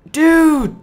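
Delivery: loud high yell of disbelief; loud shocked cry; shocked shout. Real, not acted. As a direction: shout it out, appalled. Shocked by a mess in game.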